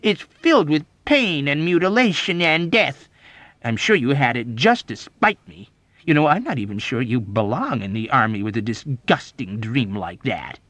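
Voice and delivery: loud, high disgust easing off; offended officer, emphatic and repelled; appalled cry. A narrator's reading. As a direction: protest in horrified indignation. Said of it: "Major Sanderson cried".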